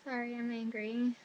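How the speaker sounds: soft, sheepish apology; small apology. Retold or imitated. Student apologises as everyone laughs, small and sheepish. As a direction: say it small and quiet. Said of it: "was like".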